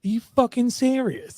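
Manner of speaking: imitated anger. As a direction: mimic her indignant question without raising the volume. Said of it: "you were, you were angry".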